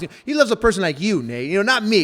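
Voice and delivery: pushed up high and loud, quick; insisting he's unworthy, voice rising; emphatic self-doubt. Retold or imitated. Man telling Nate his old doubts, rising and loud.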